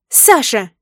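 loud, startled reproach; sharp protest. A narrator's reading. Wife jerks away as husband soaps her, sharp protest. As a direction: call his name to stop him.

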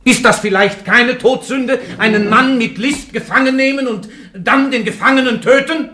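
outraged judge roaring at the accused; furious accusation. Acted. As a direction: shout her down with the accusation. Judge hurls a murder accusation.

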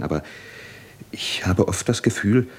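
ordinary talk

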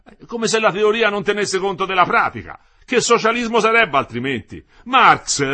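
high, very loud argumentative husband; Metello, heated retort. A narrator's reading. Husband defends theory to his wife, heated and loud.

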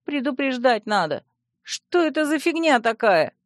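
high-pitched, indignant demand. Acted. Startled princess demands to know what this is, indignant. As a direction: complain with the pitch pushed up.

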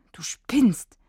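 put-on a boy hissing at a friend in disbelief. A narrator's reading. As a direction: hiss it through the teeth. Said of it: "zischt Tom".

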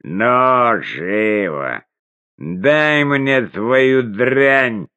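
пьяный голос, тянет слова медленно; выше и медленно, как пьяный; town drunk. Acted. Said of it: "Он пьяно засмеялся и икнул". (пьяный хозяин требует раздеть пленницу, пьяная речь)

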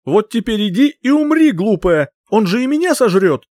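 high, loud frantic shout; panicked angry yell. Acted. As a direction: yell at her in terror. Archmage panics and yells.

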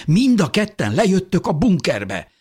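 insist, aghast and high-pitched; shocked insistence. A narrator's reading. Husband insists wife and baby come down, shocked. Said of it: "döbbenten a feleségére".

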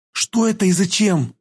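high, drawn-out surprised question; surprised girl. A narrator's reading. Girl asks about the injection, surprised. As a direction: ask in high-pitched astonishment. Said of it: "удивилась она".

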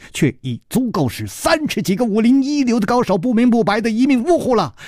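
high, rapid rush of words; urgent warning. A narrator's reading. Woman warns of the poison's deadliness.

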